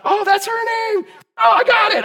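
delighted shout; excited shout. Real, not acted. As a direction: shout it as you remember. Remembering a congregant's name.

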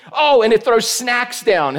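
usual speaking voice

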